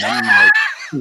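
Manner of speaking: high laughing shout; bursting laugh. Real, not acted. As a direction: crack up loudly mid-word. Laughs at creepy penguin.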